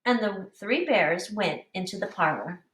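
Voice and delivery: ordinary talk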